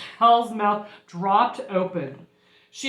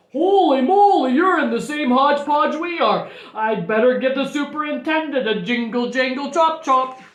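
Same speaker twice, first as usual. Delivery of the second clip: loud, raised exclamation settling into bustle; startled principal taking charge; astonished principal; 3 semitones higher, 6 dB louder than usual. Acted. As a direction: burst out in storybook amazement.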